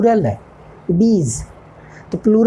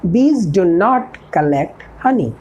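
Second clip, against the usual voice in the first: each word spaced out for learners; a teacher dictating an English model sentence, slow and clear; slow dictation; much slower than usual. Shown as a demonstration. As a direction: spell it out slowly like a teacher at the board.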